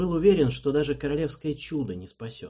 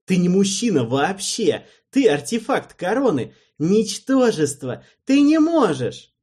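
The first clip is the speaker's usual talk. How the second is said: loud high insults; contemptuous shouting; 9 semitones higher, 9 dB louder than usual. A narrator's reading. Alvin hurls scornful insults at Erald, shouting. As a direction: spit the insults out.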